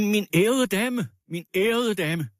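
high, insistent call; piercing shout. A narrator's reading. Man calling to the distraught lady, piercingly. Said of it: "råbte han med gennemtrængende stemme".

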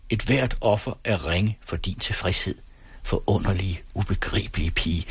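ordinary delivery, nothing marked

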